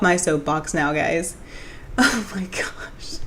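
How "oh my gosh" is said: breathy, hushed laugh; whispered through spent laughter; soft, winded giggle after a rant. Real, not acted.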